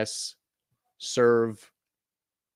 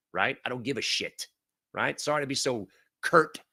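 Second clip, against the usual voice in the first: ordinary talk, nothing marked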